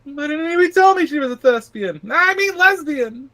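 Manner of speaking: very high-pitched Southern character voice; Southern accent. Acted. Man complaining he was never told, high Southern accent. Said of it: "he's Southern in that, uh, interpretation".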